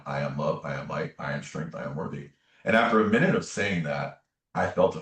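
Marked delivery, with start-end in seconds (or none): 0.0-2.3 soft, low run of affirmations; inward self-affirmation. Retold or imitated. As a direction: recite it quietly, as if in your own head. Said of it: "saying in my mind".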